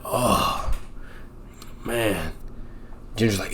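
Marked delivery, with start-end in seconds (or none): 0.1-2.3 whispered groan. Retold or imitated. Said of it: "He's like".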